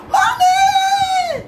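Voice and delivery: put-on high, loud child's shout; child shouting for mommy, high and loud; child calling out. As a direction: call mommy like a little child. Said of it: "tó kàn dédé pé ọ".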